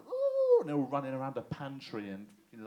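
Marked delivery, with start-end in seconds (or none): 0.1-0.6 high, loud mock squeal of excitement; hammed-up high-pitched "ooh"; excited "ooh". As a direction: imitate giddy contestants whooping. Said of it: "they're like".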